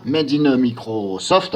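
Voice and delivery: "Made in Microsoft" said very slowly in an English accent; careful English. Shown as a demonstration. YouTuber saying "Made in Microsoft" in English, carefully. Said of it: "Cette fois-ci, je le dis bien".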